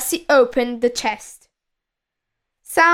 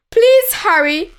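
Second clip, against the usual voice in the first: high, loud plea; raised, pleading storybook cry; urgent shout; 8 semitones higher, 5 dB louder than usual. A narrator's reading. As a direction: shout for help to come quickly. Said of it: "he shouted".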